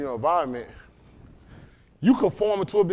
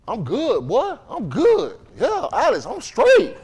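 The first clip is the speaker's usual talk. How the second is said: imitating a neighborhood guy; 5 semitones higher, slower than usual. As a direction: play the guy brushing him off with a raised voice.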